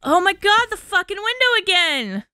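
loud, high startled outburst; alarmed. Real, not acted. Player finds the window open again, alarmed outburst. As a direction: blurt it out in alarm.

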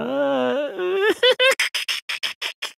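mocking laugh. Mocking the brave visitor.

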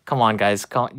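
normal talking voice

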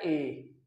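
ए held a little longer; lengthened vowel. Shown as a demonstration. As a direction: draw out ए slightly. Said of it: "थोड़ा सा लंबा करके पुकारा जाता है".

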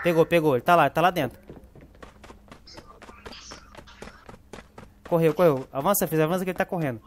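loud, urgent game callout; excited callout. Real, not acted. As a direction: shout the enemy's position. Calling out enemy position in fight.

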